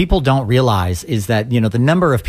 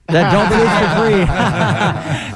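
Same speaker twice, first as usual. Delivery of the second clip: laughing; 8 semitones higher, slower than usual. Real, not acted. Laughing through a repeated joke.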